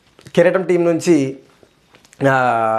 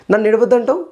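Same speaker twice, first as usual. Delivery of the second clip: mock-coy lover's teasing, pitched higher; 6 semitones higher than usual. Vlogger mocking Nikhil comforting her in a coy lover's voice. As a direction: ham up a bashful sweetheart, slowing on the last words. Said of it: "అన్నట్టు మాట్లాడుతాడు".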